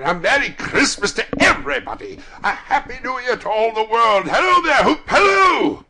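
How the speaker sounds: high, full-voiced joyful hollering; jubilant shouting. A narrator's reading. Scrooge greeting all the world, shouting with joy. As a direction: whoop it out to the whole world.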